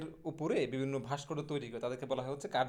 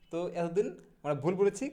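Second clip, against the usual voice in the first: ordinary talk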